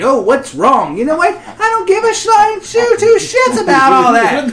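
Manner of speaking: loud, high hammed-up tirade; over-the-top fake outrage; mock rant. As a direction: rant like a man who's been wronged. Mock rant after ranch joke.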